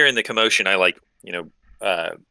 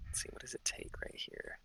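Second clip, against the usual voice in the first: whispering; 22 dB quieter than usual. Real, not acted. Muttering while reading rules.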